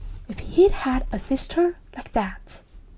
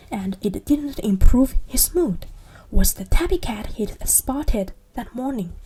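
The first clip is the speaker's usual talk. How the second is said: usual speaking voice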